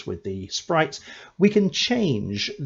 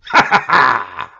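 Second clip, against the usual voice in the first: loud villainous cackle; evil cackle; 9 dB louder than usual. Shown as a demonstration. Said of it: "I'm about to do an evil laugh for the enemy".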